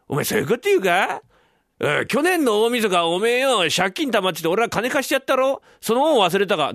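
high, loud reproach; indignant friend. Acted. Friend reminding the dreamer of a loan, indignant. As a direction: throw an old favour in his face, heated.

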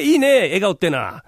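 normal talking voice

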